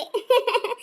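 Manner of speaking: short, high giggle; quick mocking laugh; high little burst of laughter. Visitor mocks the bald aunt.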